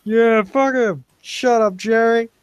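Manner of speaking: loud, high mob jeer at Jerry; crowd jeering. Acted. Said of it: "Everyone's like".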